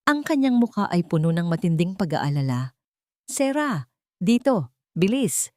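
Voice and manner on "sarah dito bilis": higher urgent call, slowly drawn out; shouting a name across the rain. A narrator's reading. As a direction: call her over fast as you run. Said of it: "ang tawag ni Gabriel".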